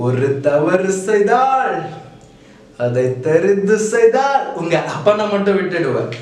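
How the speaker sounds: slow, weighty movie-hero line; slow film hero dialogue. Acted. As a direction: deliver it like a film punchline. Quoting film dialogue slowly.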